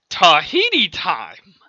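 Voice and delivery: loud, high, stretched-out call; catchphrase shout. Acted. Teleporter grabbing both of us, shouted catchphrase. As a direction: belt it out like a catchphrase.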